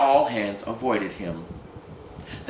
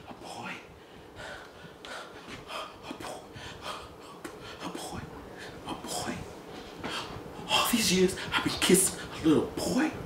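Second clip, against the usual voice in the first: hushed broken repeating; stunned whisper; 9 semitones higher, 8 dB quieter than usual. Acted. Gus stunned, whispering to himself. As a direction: numb with shock.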